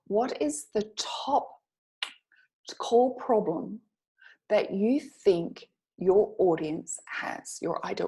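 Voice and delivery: slow and spelled out; slow, deliberate question. Shown as a demonstration. Coach slowly posing the key question to students. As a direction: lay each word down slowly. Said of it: "the first one is".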